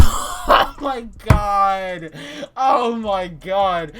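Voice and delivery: slow, breathless laughing oh my God; laughing at the memory; helpless laughter. Real, not acted. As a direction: laugh it out, barely able to speak. Cracking up remembering it.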